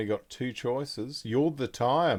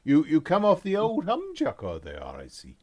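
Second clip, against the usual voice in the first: merchant voice; 4 semitones higher, 9 dB louder than usual. Acted. GM voicing the merchant NPC.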